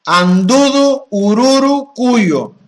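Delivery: "Andudu Ururu Kuyo" intoned slow, high and loud, vowels stretched; mantra pronunciation. Shown as a demonstration. Teacher demonstrating an ancient mantra in the golden language, slow loud intoning of "Andudu Ururu Kuyo". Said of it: "Se pronunciaba ese mantra".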